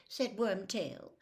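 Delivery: ordinary delivery, nothing marked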